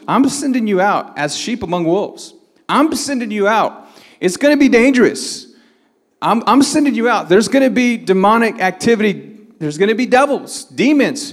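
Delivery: high-pitched preacher's warning; urgent warning. Acted. Preacher voices Jesus warning disciples.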